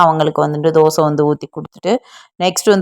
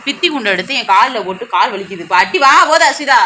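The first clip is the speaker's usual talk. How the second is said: scolding; 5 semitones higher than usual. Real, not acted. Mother scolding kids in morning.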